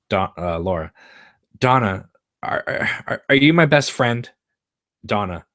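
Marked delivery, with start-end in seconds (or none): 1.6-4.3 breathless, stammering, pitched up and louder; desperate plea. Acted. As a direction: beg for a friend, voice catching. Laura, out of breath, pleads.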